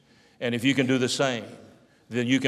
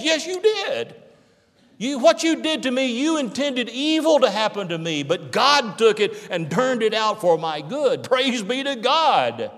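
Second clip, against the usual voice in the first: high-pitched, fervent preaching; impassioned declaration; 9 semitones higher than usual. Acted. Joseph answers the brothers who wronged him, impassioned declaration.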